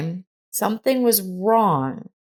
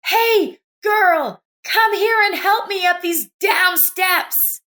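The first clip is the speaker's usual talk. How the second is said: shouted call; 13 semitones higher than usual. A narrator's reading. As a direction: call out sharply to stop her. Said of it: "she simply shouted".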